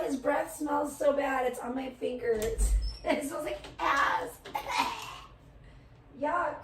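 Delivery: ordinary talk, nothing marked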